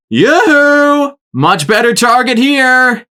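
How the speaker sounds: high, loud, slow taunting call; mocking call. A narrator's reading. Blackjack taunting the rangers to shoot her instead. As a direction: wave them over to shoot you instead. Drawing fire onto herself.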